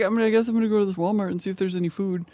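light, hurried talking-dog voice; dog impression. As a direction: play a hungry dog thinking aloud. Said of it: "That's my dog impression".

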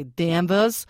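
Danvers drawn out in a Massachusetts accent. Shown as a demonstration. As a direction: say Danvers like a Massachusetts local, stretched slowly. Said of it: "How was my Massachusetts accent?".

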